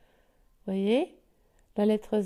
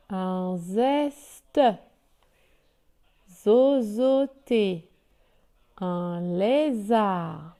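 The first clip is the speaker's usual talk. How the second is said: very slow, every syllable stretched out; slow model reading; much slower than usual. Shown as a demonstration. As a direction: read a word list for beginners to repeat, holding each syllable. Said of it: "je lis les mots". (lecture modèle très lente, syllabe par syllabe)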